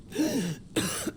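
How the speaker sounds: sharp high pained cough. Acted. Ailing inventor alone at his workbench, pained cough. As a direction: cough as the chest seizes up.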